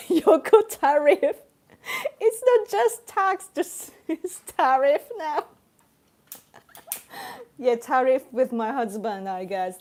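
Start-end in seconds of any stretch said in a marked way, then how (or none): 0.0-5.4 high, loud, giggly delivery; laughing exclamation. Real, not acted. Streamer joking with viewers about the Yoko tax, laughing exclamation. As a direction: crack up while saying it.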